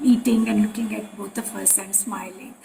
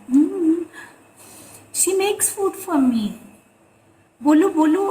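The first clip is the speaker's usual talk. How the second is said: higher imitation of the guru; Gurudev's casual prompting; 6 semitones higher, 3 dB louder than usual. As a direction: coax her to speak, pitched up. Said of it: "very casually said".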